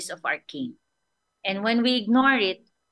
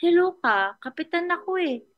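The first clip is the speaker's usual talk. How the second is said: higher, mock-indignant captain; a puffed-up captain pulling rank, higher; angry captain; 8 semitones higher than usual. Captain scolds the voice in the fog, mock anger. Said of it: "Nagalit ang kapitan".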